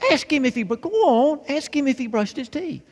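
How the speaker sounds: put-on high, loud little-girl insistence; insistent demand. Little girl insists after refusal.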